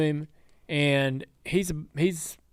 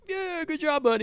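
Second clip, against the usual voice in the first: much higher, bright praise; lightly acted high cheer; cheery praise; 12 semitones higher, 3 dB quieter than usual. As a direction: pump up a kid after a race. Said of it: "I'm like".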